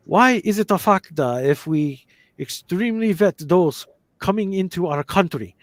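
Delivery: exaggerated Japanese-accented English. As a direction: keep the diplomat's accent going. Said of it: "in a Japanese accent".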